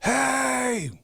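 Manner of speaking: high, drawn-out holler, not full volume; imitated yell. Man in the park hollering at nobody, imitated yell. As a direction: mimic a man yelling a long "hey". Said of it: "he would just yell".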